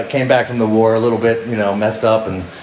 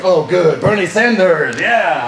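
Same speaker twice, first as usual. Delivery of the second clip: higher-pitched, Russian-sounding Icelander; botched Icelandic accent; 6 semitones higher, 3 dB louder, slower than usual. Retold or imitated. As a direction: approve heartily in a bad Icelandic accent. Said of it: "I don't know why he sounds Russian".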